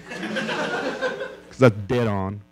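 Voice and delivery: usual speaking voice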